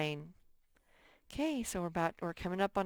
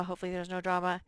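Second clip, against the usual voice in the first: rushed repeat at double speed; fast mutter; much faster than usual. Shown as a demonstration. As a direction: rattle it off quickly under breath. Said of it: "repeats phrase fast".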